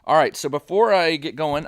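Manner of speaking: ordinary talk, nothing marked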